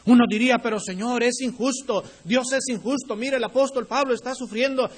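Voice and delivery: high, loud and driving, pleading with the congregation; fiery preaching. Acted. Pastor thundering to his congregation about God's justice, fiery and loud. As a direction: preach it out like a revival pulpit.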